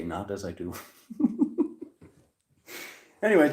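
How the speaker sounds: ordinary talk, nothing marked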